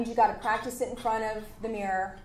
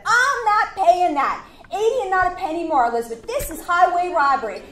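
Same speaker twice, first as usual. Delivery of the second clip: indignant client; 8 semitones higher, 8 dB louder than usual. Retold or imitated. Headhunter voicing the outraged hiring client, high and loud. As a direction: act out the client scoffing at the price.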